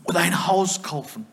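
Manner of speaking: ordinary talk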